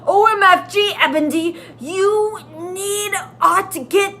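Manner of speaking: high, loud alarmed warning; worried urgent call. Acted. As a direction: urge her back in a panic. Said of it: "looking worried".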